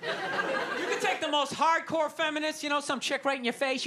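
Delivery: ordinary talk, nothing marked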